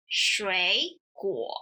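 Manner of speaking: "shui" rising, "guo" low; third-tone change. Shown as a demonstration. Teacher showing converted tone, "shui" rising, "guo" low. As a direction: draw "shui" upward, then settle low on "guo". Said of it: "first one is converted into the second tone".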